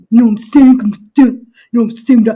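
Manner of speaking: loud mock voice of a rambling guy; mocking a rambling dude. Mocking a man who talks all day, loud voice. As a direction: lampoon a guy who won't stop talking, loud.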